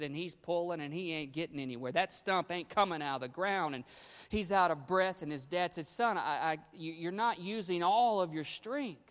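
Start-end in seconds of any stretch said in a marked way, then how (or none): 5.9-9.0 louder, higher insistent father; father insisting. Retold or imitated. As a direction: press the point on the tired son. Father repeats advice to son.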